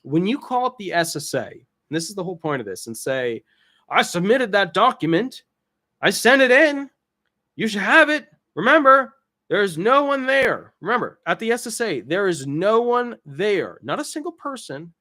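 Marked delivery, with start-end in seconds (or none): 3.9-10.7 raised, insistent plea, much louder; exasperated claimant. Retold or imitated. Claimant calling the SSA, loud and exasperated. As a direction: push it like you're fed up on the phone.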